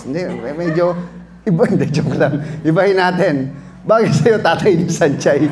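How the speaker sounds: ordinary talk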